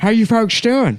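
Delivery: man doing a woman's voice. Acted.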